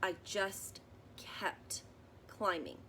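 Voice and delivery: put-on gentle read-aloud for children, measured pace; story narration. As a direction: read it like a bedtime picture book. Reading picture book aloud.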